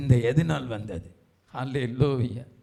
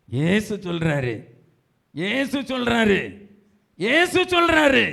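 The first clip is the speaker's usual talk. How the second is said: each repeat higher, ending in a loud high cry; building proclamation; 6 semitones higher, 4 dB louder than usual. Real, not acted. Preacher announces what Jesus says, building fervour. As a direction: climb from normal voice to a shout.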